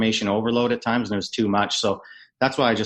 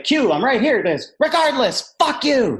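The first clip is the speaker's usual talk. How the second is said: mock retort; 14 semitones higher, 4 dB louder than usual. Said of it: "look back and be like".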